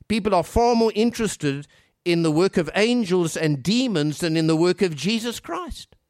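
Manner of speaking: ordinary talk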